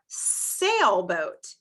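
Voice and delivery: "sailboat" slowly with a long S; emphasized S sound. Shown as a demonstration. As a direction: hold the first S of "sailboat". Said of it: "the first sound that we hear".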